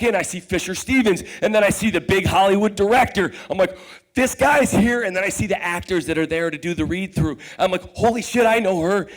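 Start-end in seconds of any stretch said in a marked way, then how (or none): 4.1-5.0 starstruck. Retold or imitated. Starstruck at seeing the director. 7.9-9.1 starstruck. Retold or imitated. Starstruck at seeing actors.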